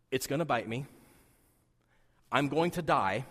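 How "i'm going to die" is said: ordinary delivery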